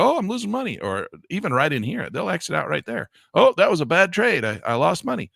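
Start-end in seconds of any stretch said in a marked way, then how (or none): 0.0-0.8 high, mocking panicky trader; mock panic over a small loss; whiny trader. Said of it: "They're like". 3.3-5.3 higher, mocking complaint; lightly voiced whiny trader. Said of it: "They're like".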